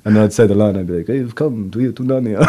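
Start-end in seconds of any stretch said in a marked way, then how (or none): 1.1-2.5 bad Spanish accent sliding towards Transylvanian; muddled Spanish-Transylvanian accent; Antonio Banderas. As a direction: attempt a Spanish accent, land on Dracula. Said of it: "a little bit of a Dracula".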